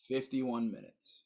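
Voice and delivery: normal talking voice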